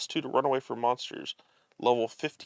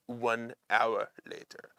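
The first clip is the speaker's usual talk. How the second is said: put-on slow, soft French-accented title card; French narrator; 3 semitones lower, 6 dB quieter, slower than usual. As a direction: announce it slowly in a bad French accent. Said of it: "French narrator".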